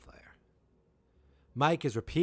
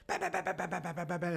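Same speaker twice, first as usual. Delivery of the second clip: rapid-fire run of bams, pitched up; imitated machine-gun fire; 7 semitones higher, faster than usual. Hopper bursts out with a machine gun, rattling imitation.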